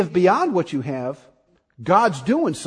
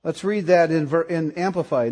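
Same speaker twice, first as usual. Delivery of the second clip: normal talking voice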